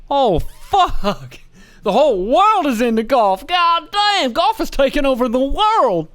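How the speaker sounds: mocking the boss overwhelmed by golf ads; mock panic. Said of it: "so he's probably like".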